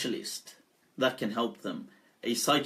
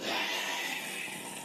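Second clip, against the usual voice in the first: a long unvoiced hiss of breath before speaking; breathy jinn sigh; 10 dB quieter than usual. Possessed man breathes out eerily before speaking. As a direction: exhale slowly like a spirit waking. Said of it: "this is exactly how he does it".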